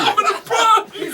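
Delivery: high, laughing through the words; cracking up while repeating the joke; giggling echo of the joke; laughing mock threat. Friends riff on crude joke.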